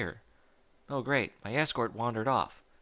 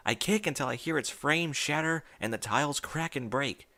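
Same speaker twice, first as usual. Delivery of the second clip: ordinary delivery, nothing marked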